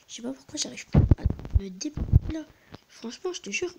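loud high complaint about the game being stuck; venting at the lag, voice jumping up; frustrated outburst. Real, not acted. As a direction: gamer yelling at his stuck character.

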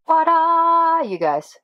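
big bright ta-da; excited reveal. Real, not acted. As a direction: present it with a flourish. Reveals the spice rack.

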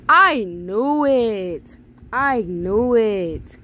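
drawn-out, loud cry of being proved right; triumphant shout. Real, not acted. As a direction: stretch each word out loudly. Crowing over a game character's predictable answer.

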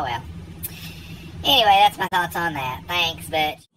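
normal talking voice

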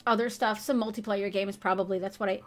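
ordinary delivery

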